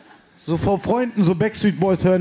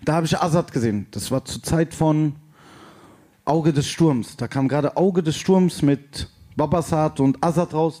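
ordinary talk

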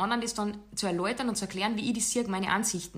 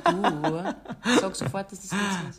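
laughing; 4 dB louder than usual. Real, not acted. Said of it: "laughing protest".

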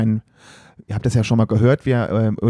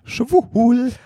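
high squeak; 10 semitones higher than usual. Pretend. Jokey high squeak on title.

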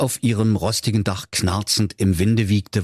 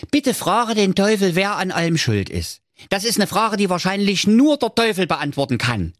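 pleading villagers; 11 semitones higher than usual. A narrator's reading.

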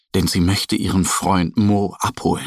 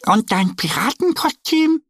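much higher duck voice; proud little duck; 13 semitones higher than usual. Acted. Annie shows off her costume, high proud voice. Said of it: "meint sie".

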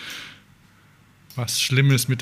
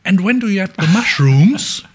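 high, loud, chirpy mock presenter; put-on perky English question; cheery TV presenter; 6 semitones higher, 6 dB louder than usual. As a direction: imitate a merry morning-show host asking brightly. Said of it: "dann fragt die Moderatorin ganz lustig".

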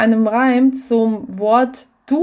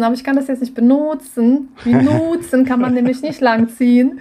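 ordinary talk, nothing marked

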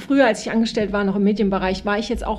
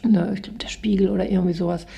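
whispered aside. Real, not acted. Aside about the source.

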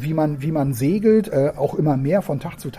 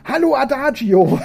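high, loud, laughing squeal; shrieking child; 9 semitones higher, 6 dB louder than usual. Acted. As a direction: squeal with delight into the radio. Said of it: "Sibyl kreischt".

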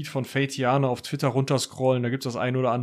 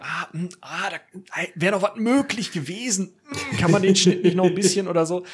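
higher, fussy muttering to himself while listening back; nitpicky inner voice; 5 semitones higher than usual. Retold or imitated. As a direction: grumble over the edit like a picky sound engineer. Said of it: "dass ich ... nicht mehr denke". (zweifelnd nörgelnde innere Stimme, höher gesprochen)

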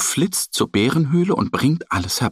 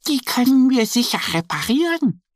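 much higher, gentle reassuring duck voice; comforting; 12 semitones higher than usual. A narrator's reading. Annie comforts sad Mo about his bag, gentle reassurance. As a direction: soothe a crying friend. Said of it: "tröstet ihn".

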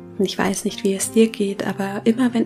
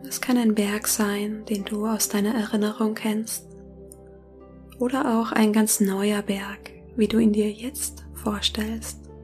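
soft spa whisper; 8 dB quieter than usual. Acted. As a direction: whisper gently, guiding them into calm. Leitet eine Meditation. (sanftes Meditationsflüstern)

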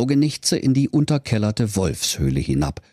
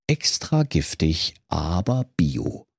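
label read dryly; 4 semitones lower, slower than usual. Acted.

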